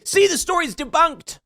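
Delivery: high sneering gloat "See, the story's debunked"; mocking gloat. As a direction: mimic a smug gloating opponent, pitched high.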